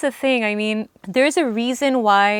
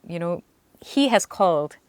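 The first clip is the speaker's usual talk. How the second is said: normal talking voice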